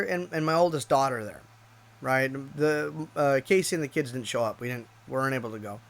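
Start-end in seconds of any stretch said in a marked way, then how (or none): none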